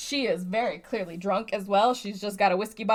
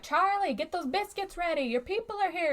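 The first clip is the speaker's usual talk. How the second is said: calling out; 9 semitones higher than usual. Retold or imitated. Calling out to Charlie.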